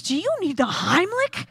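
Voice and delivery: high, swooping question; concerned bystander. Retold or imitated. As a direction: imitate an over-eager helper offering aid. Passing lady offers help to choker.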